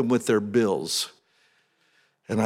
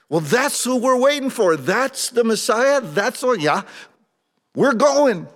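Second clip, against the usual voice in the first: eager disciples; 12 semitones higher, 5 dB louder than usual. Acted.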